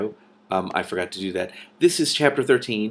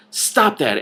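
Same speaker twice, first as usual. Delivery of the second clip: urgent; 6 semitones higher, 5 dB louder than usual. A narrator's reading. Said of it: "Eleanor said".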